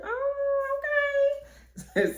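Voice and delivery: very high, drawn-out "oh, okay"; impressed surprise. Retold or imitated. Girl seeing a yard full of guys, pleasantly surprised. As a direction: stretch it out way up high.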